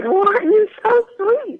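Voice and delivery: high, drawn-out coo imitating a co-star; mock gushing sweetness. Actress imitating her co-star's reply to her shy introduction, gushing.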